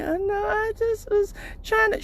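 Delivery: very high whiny mock excuse; high mock-whiny girlfriend; timid girl voice. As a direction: squeak it like caught out.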